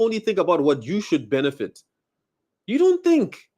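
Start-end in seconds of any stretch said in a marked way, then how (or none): 2.7-3.5 sharply higher, indignant cry; exasperated outburst. Real, not acted. Accusing foreigners of selfishness, a high exasperated cry. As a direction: throw it up in disbelief.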